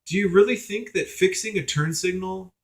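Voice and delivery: high-pitched rhetorical challenge; acted-out exasperated disbelief; incredulous retort. Imagined incredulous retort to professor.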